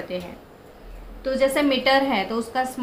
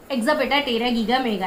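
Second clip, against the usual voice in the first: rapid memory chant; fast recital; faster than usual. Shown as a demonstration. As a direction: rattle off the whole string quickly in one breath. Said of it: "ऐसे speed में बोलना है".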